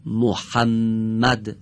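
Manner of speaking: محمد drawn out very slowly, the doubled م held long; slow demo word. Shown as a demonstration. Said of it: "خوب دقت کنید".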